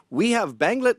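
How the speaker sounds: high, clipped start in a mock Anglophile accent. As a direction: sketch his Anglophile accent, mocking. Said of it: "he's got this strange Anglophile accent".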